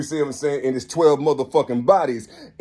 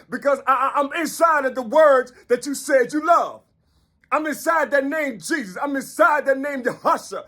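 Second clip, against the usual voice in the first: preaching at full force; 8 semitones higher than usual. Real, not acted. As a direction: cry it out as a fiery prophet. Preaching as the inner power.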